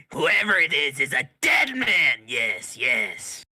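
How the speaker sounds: loud, high, slow crowing; gleeful rat creature. Acted. Laughing rat taunting its captors, loud gleeful crowing. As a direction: crow the threat in a mad creature voice. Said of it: "the rat starts laughing".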